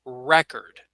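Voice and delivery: 「Record」の頭を強く、REC-ord; noun stress. Shown as a demonstration. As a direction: stress REcord on the first syllable. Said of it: "I would pronounce it like this".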